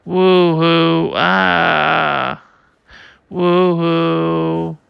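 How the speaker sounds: a huge booming wail that rattles the ship; sonic bellow. Acted. Giant boss wails his sonic voice at the ship.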